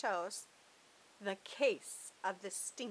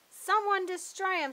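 high, loud urgent order; urgent demand; 9 semitones higher, 9 dB louder than usual. Acted. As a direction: demand it be destroyed now. Pancake demands at fruitcake.

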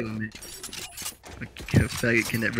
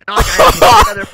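laughing rant; 15 dB louder, faster than usual. Real, not acted. Raging about banned accounts.

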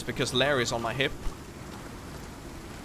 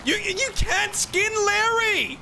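high, slow, drawn-out wail of protest; horrified protest; 4 dB louder than usual. Pretend. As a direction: cry out in playful horror. Mock horror at Larry being skinned.

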